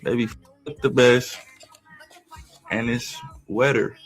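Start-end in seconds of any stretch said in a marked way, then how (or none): none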